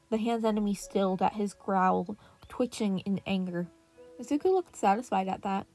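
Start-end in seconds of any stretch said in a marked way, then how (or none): none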